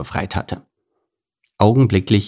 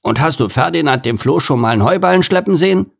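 higher fast mock growl; growling gorilla; 6 semitones higher, faster than usual. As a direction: grumble quickly about the flea. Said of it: "knurrte Gerhard".

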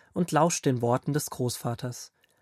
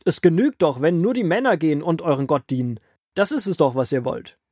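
lauter, zorniger Pharao; fährt Mose laut und gereizt an; wütend; 3 semitones higher, 4 dB louder than usual. Acted. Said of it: "rief der Pharao wütend". (Pharao schimpft wütend mit Mose und Aaron)

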